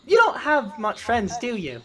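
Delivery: put-on high-pitched kid's question; a loud, high start, easing off; child character. Game master as a kid meeting Wretch the goblin, childlike.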